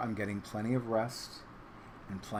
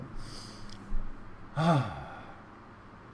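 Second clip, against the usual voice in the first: long breathy sigh; 2 semitones lower than usual. Real, not acted. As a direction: let out a long exhale. Sigh after cat hides.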